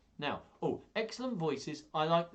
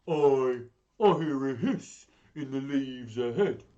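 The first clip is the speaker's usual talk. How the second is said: loud, big beast voice; Gruffalo monster; 8 dB louder than usual. Acted. Gruffalo warning mouse of a hiss, booming monster voice. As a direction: boom it out as a wary monster.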